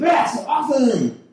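put-on slow, high, drawn-out drunk voice; a goofy drunk brain shouting slowly; drunk inner voice. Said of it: "That's what I sound like in my head when I'm drunk".

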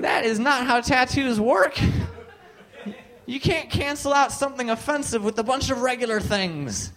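high-pitched, incredulous protest; acted indignant disbelief, pitched high; exasperated objection. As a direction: argue the obvious to a clueless friend. Acts out arguing with roommate.

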